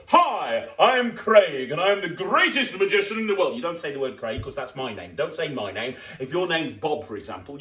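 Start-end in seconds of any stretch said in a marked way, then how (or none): 0.1-3.6 loud, higher stage-magician greeting; showman intro. Shown as a demonstration. Magician demoing his stage intro, loud and grand. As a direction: announce yourself like a star. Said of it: "You go".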